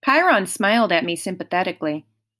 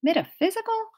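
high, questioning echo; confused boy's rising question; puzzled echo; 12 semitones higher than usual. A narrator's reading. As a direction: echo it in bafflement. Puzzled boy echoes the word.

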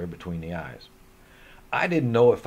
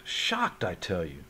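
mock shock; 3 semitones lower, 7 dB quieter, slower than usual.

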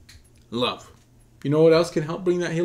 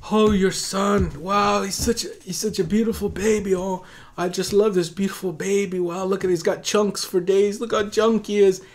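higher-pitched imitation of a delighted lady; gushing admirer; 6 semitones higher than usual. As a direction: coo over the baby in a raised, admiring voice. Retells stranger gushing over baby.

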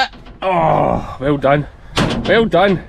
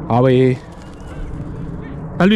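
shouted dog command; 3 semitones lower than usual. Real, not acted. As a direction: call the dog off sharply. Calling command to sheepdog.

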